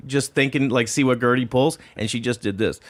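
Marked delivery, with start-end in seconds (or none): none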